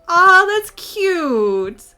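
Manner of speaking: high, loud, very slow gushing aw. Real, not acted. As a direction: gush over something adorable. Reacting to cute character.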